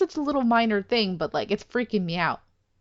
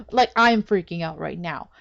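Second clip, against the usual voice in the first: ordinary talk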